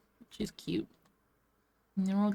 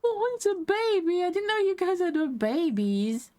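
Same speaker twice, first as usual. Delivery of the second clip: excited squeal; 12 semitones higher, 3 dB louder than usual. Real, not acted. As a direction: squeal over something adorable. Gasps at baby dinosaur.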